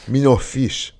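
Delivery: lightly voiced Jamaican patois; light Jamaican accent.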